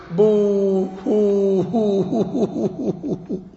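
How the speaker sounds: long wails breaking into quick sobs; both cries combined. Shown as a demonstration. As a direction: imitate crying, held boo-hoos then rapid fading pulses. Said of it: "some people do both".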